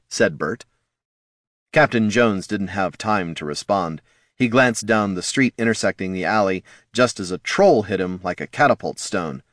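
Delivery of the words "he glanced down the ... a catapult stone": ordinary delivery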